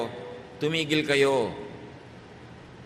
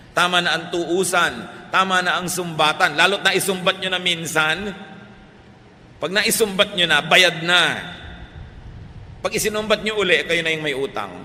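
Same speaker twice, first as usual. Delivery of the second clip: louder and higher, hammering "Tama na" in each line; full-force preaching; 5 semitones higher, 5 dB louder than usual. Acted. Preacher scolding families for blaming and reproach, fervent and loud.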